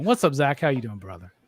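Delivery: ordinary talk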